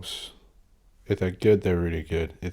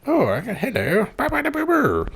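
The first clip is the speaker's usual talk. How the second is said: much higher, hammed-up posh babble; posh Jacob Rees-Mogg. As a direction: lampoon a toff burbling nonsense. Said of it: "his posh".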